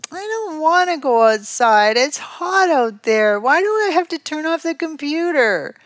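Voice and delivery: whining. Acted. Child told to leave the computer, whining. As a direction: whine it out in protest. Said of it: "I can't hear whining".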